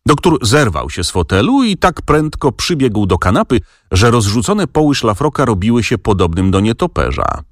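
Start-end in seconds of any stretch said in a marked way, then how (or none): none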